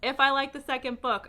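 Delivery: ordinary talk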